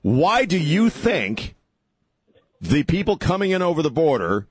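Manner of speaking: high, heated pushback; exasperated. Real, not acted. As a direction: raise it in disbelief. Host heated with caller.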